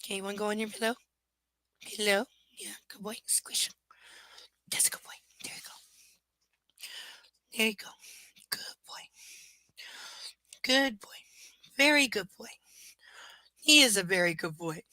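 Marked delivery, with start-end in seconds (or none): 1.8-12.5 soft whispered praise. Real, not acted. Whispering to the dog on his pillow. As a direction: hush him onto his pillow.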